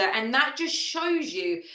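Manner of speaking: ordinary talk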